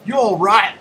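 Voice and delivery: Australian host, hammed up; Aussie accent. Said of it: "Aussie accent".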